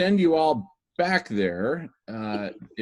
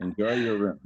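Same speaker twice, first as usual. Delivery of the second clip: laughing. Real, not acted. Host laughs sending guests away.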